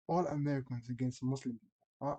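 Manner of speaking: usual speaking voice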